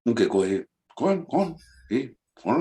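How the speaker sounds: laid-back SoCal Chicano voice, offended; Chicano accent. Acted. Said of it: "Chicano of SoCal".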